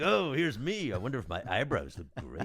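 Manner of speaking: mock vain preening voice; hammed-up self-absorbed young actor. Telling the hosts about his vain younger self, preening. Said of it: "I was like".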